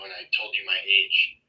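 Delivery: normal talking voice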